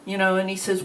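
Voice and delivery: ordinary delivery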